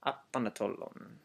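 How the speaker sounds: apanatolon run together with no O after the P; apanatolon, blending the words, no O; O dropped. Shown as a demonstration. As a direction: apanatolon said as one word. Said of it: "that O is dropped off completely".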